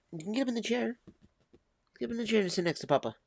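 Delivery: high sing-song call to the cat; high coaxing pet voice. Real, not acted. Owner coaxing his cat onto the chair, high pet voice.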